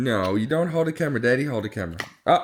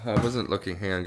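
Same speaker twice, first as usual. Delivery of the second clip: ordinary talk, nothing marked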